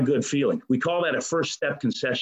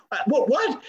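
higher, incredulous what; stunned disbelief; 11 semitones higher than usual. Retold or imitated. Told they're going back, a higher, stunned what. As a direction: sputter in shock. Learns they must go back.

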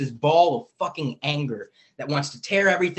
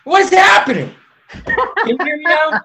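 much higher and louder, baffled by the dropout; startled confusion; 13 semitones higher, 10 dB louder than usual. Real, not acted. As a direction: yelp in disbelief, laughing. Audio cut out, confused.

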